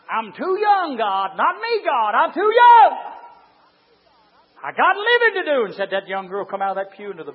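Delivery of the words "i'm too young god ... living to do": high protesting holler, getting louder; preacher imitating a girl's hollered refusal; retold screaming and hollering. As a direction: plead with God in rising cries. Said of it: "began to scream and holler".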